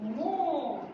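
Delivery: drawn-out mooing, voice changed; cow moo imitation. Shown as a demonstration. Teacher showing the class how a cow moos, mock moo. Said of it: "काऊ से".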